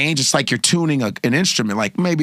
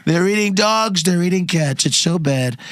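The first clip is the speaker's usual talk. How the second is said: high, slow, drawn-out Trump voice; slow hammed-up Trump rant; Trump impression; 4 semitones higher than usual. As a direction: do Trump ranting at the debate. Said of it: "He just goes".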